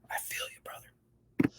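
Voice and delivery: soft breathy whisper. Real, not acted. Commentator consoling the storyteller, whispered. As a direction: murmur it in sympathy.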